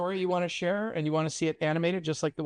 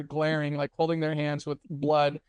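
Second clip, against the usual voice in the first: ordinary talk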